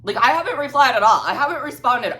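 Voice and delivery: high, loud, fast protest; exasperated emphasis. Real, not acted. Exasperated by incoming texts.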